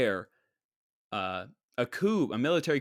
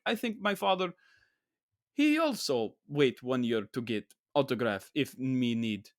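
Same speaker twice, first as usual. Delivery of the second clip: lightly voiced broken Eastern European English; imitating a foreign father's story in broken English with an Eastern European accent. Vlogger quotes Tom Hanks's line in an Eastern European accent. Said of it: "weird broken English, Bulgarian, Russian accent".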